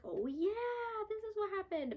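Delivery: a realization popping back while reading; sudden recognition. Retold or imitated. Retelling her own reading, a high remembering exclamation. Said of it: "I was like".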